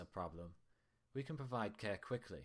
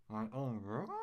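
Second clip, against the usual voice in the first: muffled through a sandwich; mouth full; 2 semitones higher, 3 dB louder, slower than usual. Acted. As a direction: talk with your mouth full. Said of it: "her voice muffled by the sandwich".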